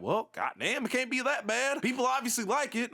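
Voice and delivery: lightly voiced country accent. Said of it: "I just did a country accent".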